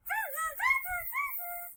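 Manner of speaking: high-pitched "tsz tsz tsz" monkey noise; squeaky hissed monkey chattering. A narrator's reading. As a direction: chitter mockingly like a monkey. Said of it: "the monkeys shook their fingers back at him and said".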